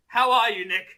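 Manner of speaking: ordinary delivery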